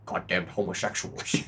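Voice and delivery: put-on low, soft old-politician mutter; mock presidential grumble. Comic undercutting the moon speech, low grumbling president.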